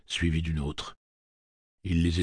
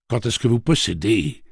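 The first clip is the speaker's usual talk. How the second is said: loud, higher, booming over the other voice; thundering; 5 semitones higher, 5 dB louder than usual. A narrator's reading. Roland cutting off Callahan, thundering voice. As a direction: drown him out. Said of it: "tonna Roland d'une voix qui couvrit celle de Callahan".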